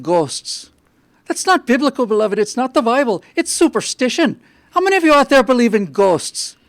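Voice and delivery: put-on a young Irish priest scolding his flock. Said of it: "with an Irish accent".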